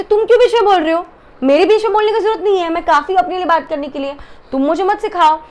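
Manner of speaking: high, quick telling-off; mock indignant retort. Reviewer imitating contestant refusing housemate's support, indignant.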